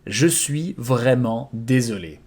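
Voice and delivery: "Je suis vraiment désolé" spoken slowly, every syllable spelled out; slow it down so learners catch each sound; slow model repetition. Shown as a demonstration. Teacher slowing the phrase for students, slow. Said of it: "I'm truly sorry".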